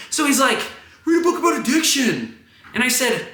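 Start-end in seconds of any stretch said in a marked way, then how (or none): 1.0-2.4 high-pitched shout, imitating an angry stranger; strained, high street yell; heckler's yell. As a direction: holler it high at a passerby. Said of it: "this man yelling at me".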